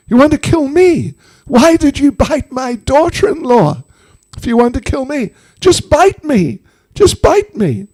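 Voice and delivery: high-pitched, frantic challenge; Jatila's distraught protest. Acted. Mother-in-law daring the snake to bite her, distraught.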